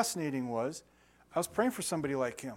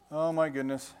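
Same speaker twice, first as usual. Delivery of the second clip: normal talking voice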